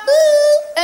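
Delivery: high, loud, held "ooh"; high call. Acted. Colleague hailing Michel across the shop, high hooting call. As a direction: sing out a long hoot to get attention.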